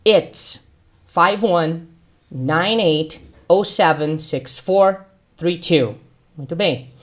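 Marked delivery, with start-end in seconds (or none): 0.1-6.0 slow, clear "It's five one nine eight oh seven six four three two"; teacher spelling out the whole number; model number. Shown as a demonstration. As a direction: read the number slowly for repetition. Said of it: "repita comigo o número todo".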